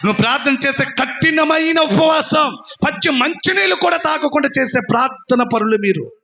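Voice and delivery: high, shouted sermon climax; full-force preaching. Acted. Preacher hailing those who fast without water, full-force preaching. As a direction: cry out each phrase from the pulpit.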